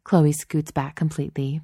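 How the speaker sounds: plain reading voice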